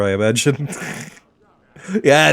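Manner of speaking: ordinary talk, nothing marked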